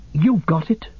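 high, incredulous gasp; stunned, high-pitched disbelief; astonished gasp. Acted. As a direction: gasp it out in amazement, pitch leaping. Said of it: "gasped Frodo".

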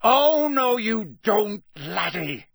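high, very loud roar; long roared-out warning. Acted. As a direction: bellow it to stop him dead. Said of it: "a roar that echoed through the entrance hall".

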